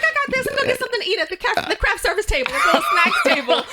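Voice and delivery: high, quick hammed-up bragging with laughter; cocky. Retelling leaving rehearsal for snacks, mock cocky. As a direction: rattle it off as your cocky younger self. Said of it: "I was cocky".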